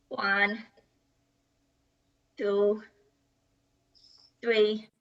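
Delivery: low, soft and dragged out, each number a chore; a grudging, exhausted count with long pauses; worn-out counting. Acted. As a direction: count like your arms are about to fall off. Tired child forced to fan.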